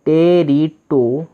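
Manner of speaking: टेरिटो stretched out slowly, syllable by syllable; teacher sounding out the word; slow blended syllables. Shown as a demonstration. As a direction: draw out each syllable. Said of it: "तो बन गया टो".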